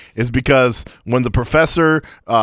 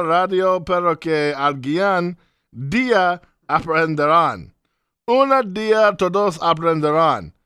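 ad read in a put-on Spanish accent, higher; 4 semitones higher than usual. Co-host reading a Spanish ad to listeners, put-on Spanish accent. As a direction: read the sponsor spot in a thick Spanish accent. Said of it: "Why'd you say it in that accent like that".

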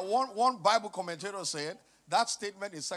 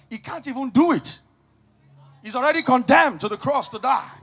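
preaching at full force; 4 semitones higher, 15 dB louder than usual. Acted. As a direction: drive each phrase home to the congregation.

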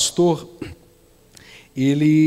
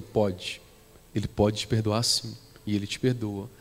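much lower and softer, gently reassuring; tender reassurance; 7 semitones lower, 7 dB quieter than usual. Acted. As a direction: speak softly, as if comforting someone in tears. Pastor assures witch of forgiveness.

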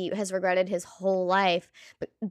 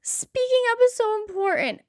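high emphatic; 13 semitones higher than usual. Shown as a demonstration. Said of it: "So I know what I mean".

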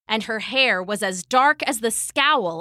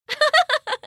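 high, mocking burst of laughter; scornful laugh; 3 dB louder than usual. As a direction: laugh off the very idea. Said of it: "Arachne laughed".

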